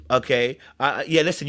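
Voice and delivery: usual speaking voice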